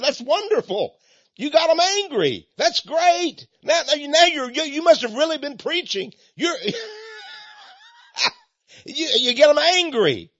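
put-on a gleeful, jumping-up cheer for the young pastor; excited delight. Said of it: "Jonathan Edwards jumped up".

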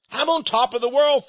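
happy boast to the folks watching; elated. Real, not acted. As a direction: proclaim it like a man on a high. Exulting again to viewers.

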